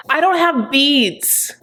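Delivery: high, slow, whiny complaint; mock whine. [name] complaining to Usman during prayer, high and whiny. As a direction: draw it out like a bored child.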